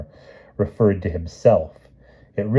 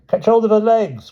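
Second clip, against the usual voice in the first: put-on very high, loud child's shout; urgent call to brothers; boy crying out; 6 dB louder than usual. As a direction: Gawain directing his brothers. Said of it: "cried Gawain".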